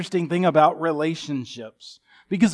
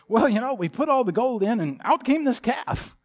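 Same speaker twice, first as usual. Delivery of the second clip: much higher, innocent excuse voice; sheepish Aaron excuse; 7 semitones higher than usual. Retold or imitated. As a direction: play Aaron shrugging it off. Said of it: "Aaron says".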